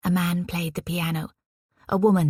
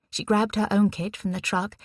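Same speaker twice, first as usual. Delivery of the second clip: ordinary delivery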